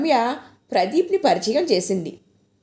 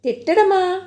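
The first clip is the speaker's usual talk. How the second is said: surprised; 8 semitones higher than usual. A narrator's reading. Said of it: "లోలోపల ఆశ్చర్యపోయింది".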